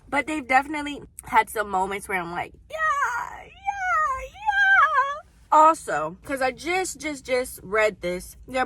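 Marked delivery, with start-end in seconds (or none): 2.7-5.2 very high squealed yeahs; swooning yeah. Retold or imitated. Swooning over romantic moments.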